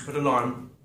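ordinary talk

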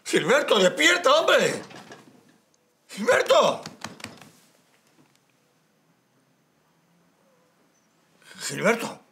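shouting a name to wake someone; calling out. Acted. Calling to wake sleeping man.

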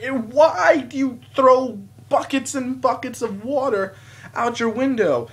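high, loud, slowed mock protest; exasperated demand. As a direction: plead loudly for an explanation. Acts out questioning the lady.

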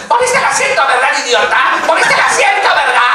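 high, loud bossy woman's voice; bully him with a shrill demand; Rasputia. Retold or imitated. Rasputia accusing Norbit about the seat, high loud scolding. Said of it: "grabar a Rasputia diciéndole".

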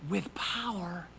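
breathy hush on the words; hushed preacher's whisper. Real, not acted. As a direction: whisper it like a secret.

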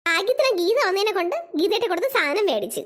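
normal talking voice

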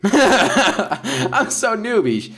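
slow, high words through a laugh; laughing at herself. Real, not acted. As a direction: giggle at your own clumsiness. Laughs at own mistake.

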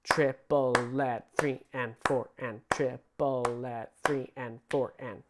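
"triplet, three and four and" counted slow; slow rhythmic count; slow counted line. Shown as a demonstration. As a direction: count it out slowly on the pulse. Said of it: "So this whole line would go".

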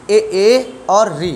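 very slow, each vowel drawn out; slow listing. Shown as a demonstration. Teacher slowly listing the front vowels for students. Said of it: "तो आप बताओगे".

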